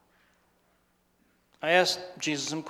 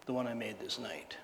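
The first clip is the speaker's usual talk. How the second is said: hushed whisper; 5 semitones lower, 11 dB quieter than usual. Acted. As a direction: drop to a whisper for the confession. Confessing his fateful decision.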